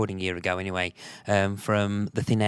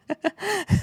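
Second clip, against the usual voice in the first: a short, high, real laugh bursting out; high burst of laughter; 4 dB louder than usual. Real, not acted. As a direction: laugh out loud, high and bright. Host laughs during chat.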